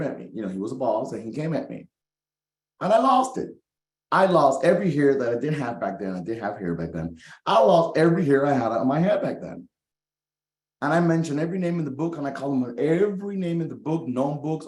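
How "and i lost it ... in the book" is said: much higher and louder, fired up; heated storytelling. Real, not acted. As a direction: tell it like it still burns. Retelling blowup with boss.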